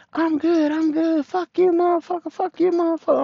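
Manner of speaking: very high-pitched mock cursing; imitated angry ex. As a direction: mimic her brushing everyone off, high and heated.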